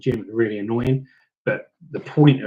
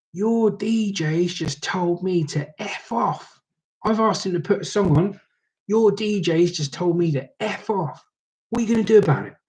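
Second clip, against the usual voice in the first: aggrieved sister; 7 semitones higher than usual. Retold or imitated. Groom's sister telling tales to her brother, high mock-outraged complaint. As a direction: imitate an indignant tattletale, pitched well up.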